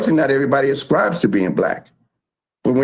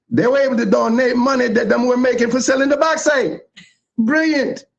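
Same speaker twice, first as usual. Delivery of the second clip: put-on Jamaican accent, pitched much higher; hammed-up Jamaican lilt, voice raised high throughout; false Jamaican accent; 12 semitones higher than usual. Honoring his co-host's Jamaican heritage, in a put-on Jamaican accent. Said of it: "I'm using my false Jamaican accent".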